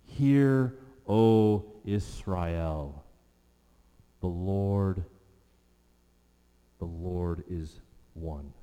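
slow, fading, reverent reading; reverent recital. Acted. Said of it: "as he speaks to your heart".

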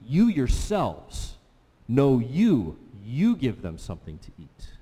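YOU punched hard and loud, slowly; emphatic you. Shown as a demonstration. Pastor stressing Jesus's YOU to the disciples, loud and slow. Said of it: "you" is emphatic here".

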